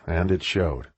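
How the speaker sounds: ordinary delivery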